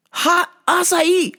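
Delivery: ordinary delivery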